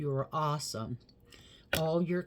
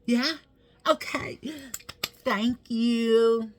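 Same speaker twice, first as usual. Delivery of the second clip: very high, louder cartoonish squeal; squeaky playful voice; 9 semitones higher, 9 dB louder, slower than usual. Real, not acted. Playful high thanks to viewers.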